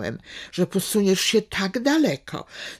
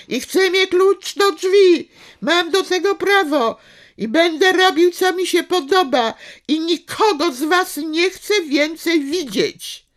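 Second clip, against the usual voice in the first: much higher and louder, yelling in defiance; furious shout; 11 semitones higher, 7 dB louder than usual. Acted. Son yelling his defiance at his father, furious. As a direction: scream the demands at the parents. Son's climax of rebellion.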